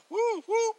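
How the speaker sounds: very high-pitched little double whoop; high woo-woo cheer. Real, not acted. Cheering for the Justice Society of America, high whooping.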